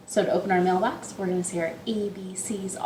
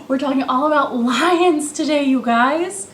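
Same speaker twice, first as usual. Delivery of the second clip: excited; 5 semitones higher, 6 dB louder than usual. Real, not acted. Excited lion reveal to kids.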